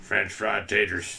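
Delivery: slow, halting lightly voiced drawl; half-hearted film character impression; Sling Blade. Husband tries the movie voice his wife mentioned, rough impression. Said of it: "you sounded like Sling Blade".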